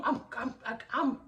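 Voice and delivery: flustered, halting repeats; retold stutter. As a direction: trip over the first word, reenacting panic. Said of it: "I'm stuttering".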